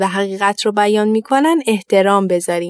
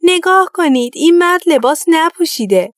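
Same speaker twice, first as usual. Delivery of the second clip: put-on high, loud child's cry; little boy calling out; 8 semitones higher, 3 dB louder than usual. A narrator's reading. A little boy in the crowd shouts to everyone, high and loud. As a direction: call it out like a small boy who can't believe his eyes. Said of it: "صدای پسرکی بلند شد".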